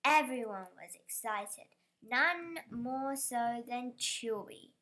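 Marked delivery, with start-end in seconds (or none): none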